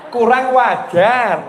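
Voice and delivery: high, loud and very slow; drawn out. Pretend. Preacher playfully corrects his pun, slow and loud.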